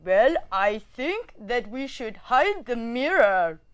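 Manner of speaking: light mock German accent. Student taking a turn in warm-up, German accent. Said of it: "I'm gonna do German".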